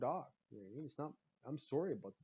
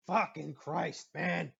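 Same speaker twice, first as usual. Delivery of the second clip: ordinary talk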